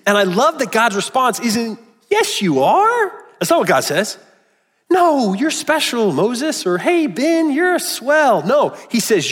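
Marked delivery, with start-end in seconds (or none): 2.1-3.1 high, slow, sing-song yes you are; mock cheerful reassurance. Mock reassurance God doesn't give. 4.9-8.4 high, gushing pretend compliment; mock flattery. Mock flattery God doesn't give.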